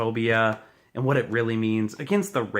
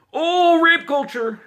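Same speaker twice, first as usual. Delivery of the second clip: much higher, louder, slower mock whine; mocking whiny voice; 7 dB louder than usual. Ranter mocking critics in a high whiny voice. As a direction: sneer it like a whiny activist.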